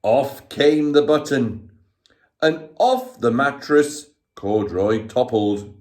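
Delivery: ordinary delivery, nothing marked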